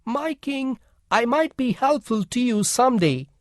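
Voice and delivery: little mouse replying. A narrator's reading. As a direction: answer humbly as the little mouse. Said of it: "Then the mouse replied".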